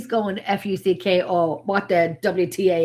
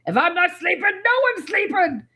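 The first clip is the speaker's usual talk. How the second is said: playful tyrant decree; mock bossy; 8 semitones higher than usual. Real, not acted. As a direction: proclaim it like a strict boss. Joking about strict night sessions.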